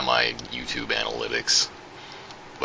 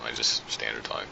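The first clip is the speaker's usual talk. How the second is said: muttered aside; 3 semitones lower, 8 dB quieter than usual. Real, not acted. Aside about her own voice.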